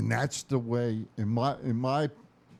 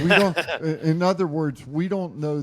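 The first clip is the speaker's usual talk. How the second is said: laughing; 6 semitones higher, 7 dB louder than usual. Real, not acted. Laughing after a joke.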